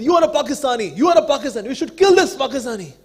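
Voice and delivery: loud, high angry mob yell; mob shouting. Retold or imitated. Angry mob shouting at a Pakistani traveller.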